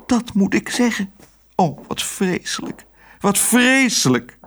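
high, anguished lament; theatrically distraught, pitched well up; despairing mayor. A narrator's reading. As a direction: wail in dismay, drawing out the last words. Mayor despairs over the missing charter.

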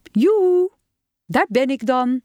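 high sing-out hello; hallooing to announce yourself; cheery arrival call. A narrator's reading. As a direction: call yoo-hoo to a group, pitched high. Zookeeper calls out his arrival.

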